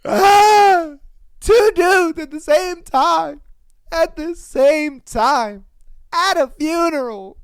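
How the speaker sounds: high, loud, slow laughing wail; laughing in disbelief at the funeral detail. Real, not acted. Laughing mock anguish.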